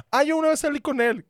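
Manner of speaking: high mock voice of a guy commenting. Guys commenting on her photo. (burla aguda de un tipo presumido)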